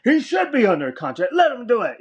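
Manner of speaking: Vince. Retold or imitated. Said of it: "And he's like".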